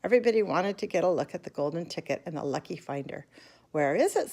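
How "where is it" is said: ordinary delivery, nothing marked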